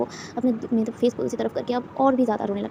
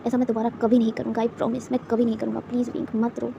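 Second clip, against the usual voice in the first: tender apology; 7 dB quieter than usual. A narrator's reading. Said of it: "हग करते हुए कहता है".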